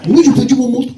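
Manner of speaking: English tourist looking for his watch, in broken French; English accent French. Retold or imitated. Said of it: "un touriste anglais".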